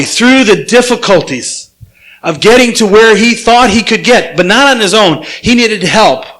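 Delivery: ordinary talk, nothing marked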